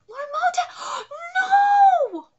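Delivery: crying out high and loud in real despair; anguished shout. Real, not acted. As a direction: scream his name as he falls. Reactor sees Moten in danger.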